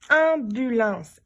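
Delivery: drawn out syllable by syllable; stretched slowly to hear each syllable; slow syllable-timed. Shown as a demonstration. Teacher sounding out a word slowly for pupils. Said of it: "je dois me dire".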